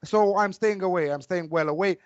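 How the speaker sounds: normal talking voice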